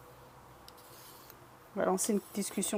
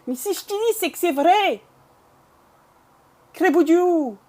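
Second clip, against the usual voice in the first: loud, high Bressan country accent; hammed-up Bressan dialect, pitched up; 7 semitones higher, 9 dB louder than usual. Shown as a demonstration. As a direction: exclaim in a broad rural Bresse accent. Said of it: "Alors, l'accent bressan".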